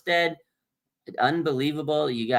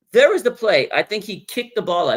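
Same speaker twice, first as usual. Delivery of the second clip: usual speaking voice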